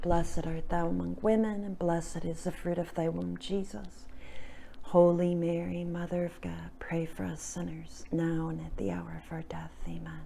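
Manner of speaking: soft prayer fading to a whisper. Acted. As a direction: murmur the prayer, trailing into a whisper. Recites the Hail Mary.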